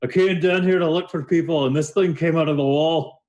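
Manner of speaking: high, amused character voice; giggling character voice. Acted. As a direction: tell it gleefully, pitched well up. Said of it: "he's giggling to himself, and he goes".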